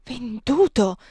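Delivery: a loud, aghast cry in another voice; both cry out in dismay at the news; shocked cry. Acted. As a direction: shout the word in horror. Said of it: "gridarono quelli con un gesto di spavento".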